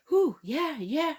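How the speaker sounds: high, rising whoo at hot chestnuts; hot-food whoo. Retold or imitated. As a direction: blow out as if the chestnut burns your fingers. Hot chestnut in cold hands.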